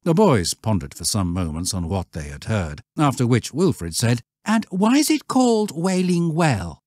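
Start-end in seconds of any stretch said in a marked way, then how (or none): 4.4-6.8 lightly voiced boy, higher; curious boy. A narrator's reading. Wilfred asking the shepherd about the well, higher boy voice. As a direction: ask it like an eager schoolboy.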